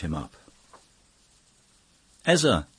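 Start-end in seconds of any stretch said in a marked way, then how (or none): 2.3-2.7 a sudden loud, high cry of his friend's name; cry of surprise. A narrator's reading. Poet greets his old friend with a loud, surprised cry. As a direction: call out in pleased astonishment. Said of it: "cried the poet".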